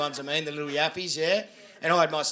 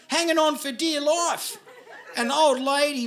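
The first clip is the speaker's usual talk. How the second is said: ordinary talk, nothing marked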